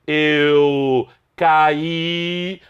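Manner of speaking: "eu caí" very slow, with A and I as separate syllables; hiatus demonstration. Shown as a demonstration. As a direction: stretch "caí" into ca-í, holding the final stressed I. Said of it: "houve a formação de um hiato entre o A e o I". ("eu caí" bem alongado, separando o A do I em hiato)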